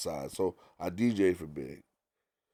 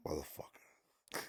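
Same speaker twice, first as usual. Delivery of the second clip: muttered curse; 4 semitones lower, 17 dB quieter, slower than usual. Retold or imitated. His own muttered reaction.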